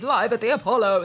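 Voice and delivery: booming announcer; big showbiz announce. Retold or imitated. Announcer voice for show title.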